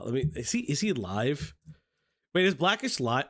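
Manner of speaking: usual speaking voice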